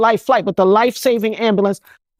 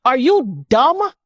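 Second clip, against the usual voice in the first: slow, drawn-out question, rising at the end; incredulous; 3 semitones higher, much slower than usual. Real, not acted. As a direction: stretch each word in disbelief at the critics. Rebukes her critics.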